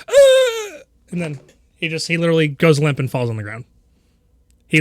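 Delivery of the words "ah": put-on high startled shriek from a terrified boy; frightened yelp. Boy seeing the stranger, high panicked yelp. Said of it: "just goes".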